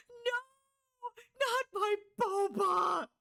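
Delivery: high, slow wailing cry; high drawn-out mock cry. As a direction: wail over the lost drink, hammed-up. Losing her boba.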